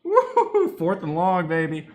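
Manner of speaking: cheering like a fan in the stands; gleeful whoop. Real, not acted. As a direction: crow with joy at fourth and long. Celebrating defensive stop live.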